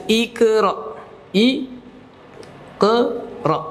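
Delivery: ikra with the q sound heavy and drawn out; qaf said wrong. Shown as a demonstration. Teacher modelling ikra for students, slow and careful. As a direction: stretch ikra slowly as a model reading. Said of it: "ya dibaca ke".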